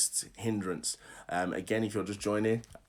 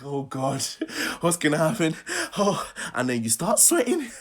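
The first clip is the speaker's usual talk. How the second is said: hammed-up panic, higher and louder; mock panic; 6 semitones higher, 5 dB louder than usual. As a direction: act out rising nerves, half laughing. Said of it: "you're panicking".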